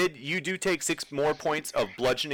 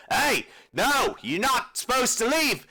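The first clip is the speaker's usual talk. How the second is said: higher-pitched guard calling out a challenge; second guard; 11 semitones higher than usual. Acted. Guard to escaping Barry, alarmed shouted challenge. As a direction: shout after someone who's getting away. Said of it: "you hear the second guard".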